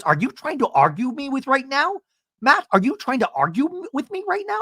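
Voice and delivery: high, rising disbelief; hammed-up huffy question; mock incredulous. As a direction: challenge him in outrage. [name] arguing with Matt.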